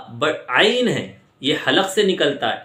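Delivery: ordinary talk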